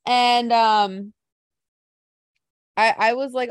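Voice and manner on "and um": ordinary talk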